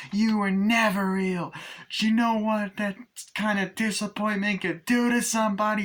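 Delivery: a lightly voiced hurt Tiffany. Voicing Tiffany.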